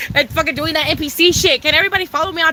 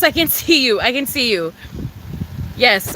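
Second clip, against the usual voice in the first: normal talking voice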